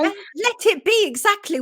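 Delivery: high, eager echo of agreement; excited agreement. Real, not acted. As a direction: agree brightly, voice jumping up. Host echoes guest enthusiastically.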